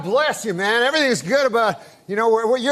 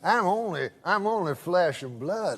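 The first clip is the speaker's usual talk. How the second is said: put-on Georgia good old boy spinning a yarn, lower and drawled; Southern good old boy; 6 semitones lower, 8 dB quieter than usual. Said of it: "I can tell by the accent".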